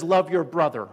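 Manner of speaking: a preacher driving the verse home; emphatic command. Acted. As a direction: hit the command hard.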